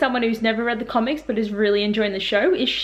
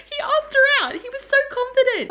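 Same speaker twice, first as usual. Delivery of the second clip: much higher, giggly gush; squealing excitement. Real, not acted. Vlogger gushing to viewers, high and giggly. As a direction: squeal with delight.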